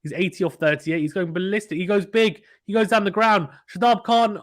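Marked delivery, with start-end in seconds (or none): 1.8-4.4 rising, higher and louder as the ball flies; excited call. Real, not acted. Commentator calling a huge hit, building excitement. Batter swings big, live call.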